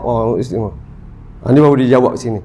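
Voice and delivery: ordinary talk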